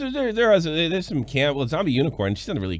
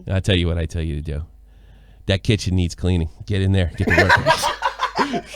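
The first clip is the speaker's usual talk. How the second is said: bossy husband; 11 semitones lower than usual. Pretend. Husband ordering wife to clean.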